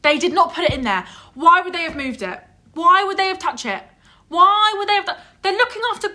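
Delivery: high, loud, escalating disbelief; indignant rant. Real, not acted. Friend defending the blamed cleaners, increasingly indignant.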